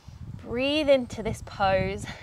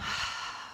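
long breathy sigh out the mouth; dramatic sigh; 7 dB quieter than usual. Shown as a demonstration. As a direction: drop it all with a big sigh. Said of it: "sigh it out and drop the shoulders down".

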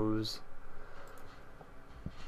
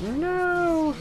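mock scream; 5 dB louder than usual. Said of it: "And I'm like".

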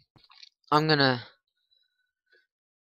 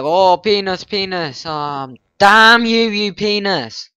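mock Anders outburst; 6 semitones higher, 10 dB louder, slower than usual. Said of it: "Anders would be like".

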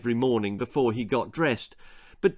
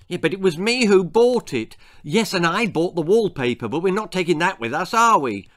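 bickering; 6 semitones higher, 5 dB louder than usual. A narrator's reading. Parents bickering while packing.

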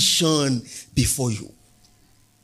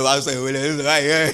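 mock sniffy; 2 semitones lower, 6 dB louder, slower than usual. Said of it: "some of you lifted your nose".

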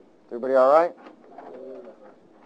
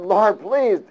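higher, louder comic pleading prayer; mock praying sailors; 6 semitones higher, 4 dB louder than usual. Sailors praying before throwing Jonah, comic plea. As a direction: plead with the Lord half joking. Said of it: "They said".

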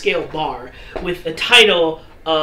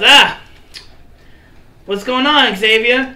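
dwarf bouncer voiced; 5 semitones higher, 5 dB louder than usual.

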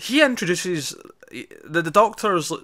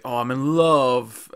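slow, drawn-out mock swoon; hammed-up lovesick sigh of a line; lovestruck; 4 dB louder, slower than usual. Retelling a millionaire mooning over a photo, mock lovestruck.

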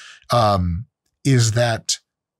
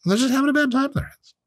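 much higher, fast put-on squeak; high mock voice; faster than usual. Mocking the critics with a silly high, fast voice. Repeats the line in another voice.

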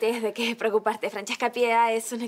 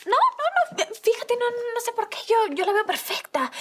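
flustered protest; 9 semitones higher, 3 dB louder than usual. Real, not acted. Flustered denial to boss.

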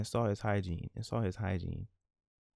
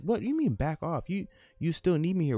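higher-pitched, acted indignant comeback; 8 semitones higher than usual. Man voiced answering his partner's "back off", indignant. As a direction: push back like a boyfriend in a quarrel.

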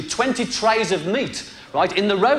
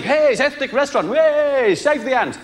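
high, loud gleeful hippie cheer; ecstatic Joe; 8 semitones higher, 5 dB louder than usual. Retold or imitated. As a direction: cheer like a thrilled eco hippie. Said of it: "Joe is ecstatic".

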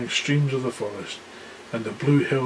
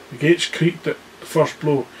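ordinary delivery

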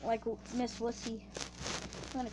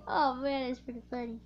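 ordinary talk

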